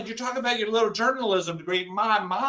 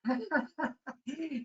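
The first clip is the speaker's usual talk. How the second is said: mother's laugh; 6 semitones higher, 10 dB quieter than usual. Retold or imitated. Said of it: "My mama started laughing".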